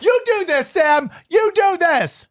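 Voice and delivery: exasperated giving up; wife handing over. Retold or imitated. Wife fed up with the call, pushing it to husband in a high huff.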